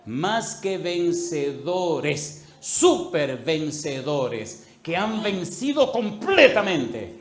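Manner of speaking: very slow, loud, rising; slow emphatic proclamation. Real, not acted. Preacher explains super conquerors to the congregation, slow and forceful. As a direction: hammer each word home.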